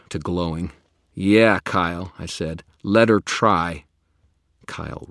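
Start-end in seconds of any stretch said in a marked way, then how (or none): 1.1-2.1 slow, loud defiant taunt. Acted. Wizard taunts vampire. 2.8-3.8 slow, loud defiant dare. Acted. Wizard dares the vampire.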